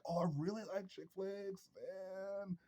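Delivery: high, soft falsetto mockery; mocking feminine voice. Mocking a man who copies the girls, high soft falsetto. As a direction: sneer at him with a high sugary voice.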